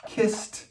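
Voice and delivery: "kissed" jumps way up, ending in a crisp T; stressed past form. Shown as a demonstration. Teacher stressing the past form "kissed" to students. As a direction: leap up on "kissed". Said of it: "in the past".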